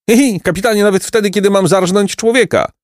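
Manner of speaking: very high tittering character voice; giggling bandit. A narrator's reading. Chubby bandit says he always laughs, high giggly voice. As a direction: squeal it with acted giggles. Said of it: "mówił śmiejąc się zbójca".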